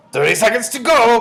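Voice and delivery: high, very loud countdown; witch crying out. A narrator's reading. Grand High Witch grips Bruno counting down, crying out. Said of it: "cried the Grand High Witch".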